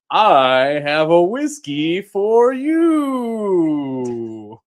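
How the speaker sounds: slow, drawn-out Eagle Man announcer voice. Acted. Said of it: "Eagle Man voice".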